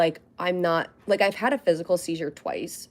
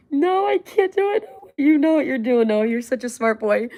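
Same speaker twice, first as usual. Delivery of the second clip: much higher and louder pet voice; 7 semitones higher, 6 dB louder than usual. Real, not acted. Owner praising Noah the dog in high baby talk.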